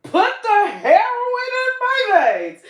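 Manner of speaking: high, loud, laughing mock announcement; mock addict. Mocking someone who announces they want drugs, high and laughing. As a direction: ham it up like a cheerful junkie. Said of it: "just go and say".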